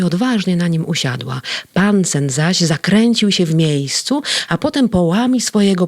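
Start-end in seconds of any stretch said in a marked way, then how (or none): none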